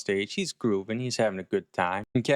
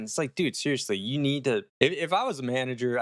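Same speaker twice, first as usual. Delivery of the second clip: ordinary talk, nothing marked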